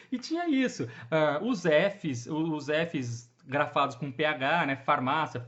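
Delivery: ordinary talk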